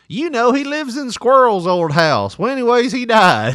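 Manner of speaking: put-on gossipy old mom. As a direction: rattle on like an old mother. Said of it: "I've become the".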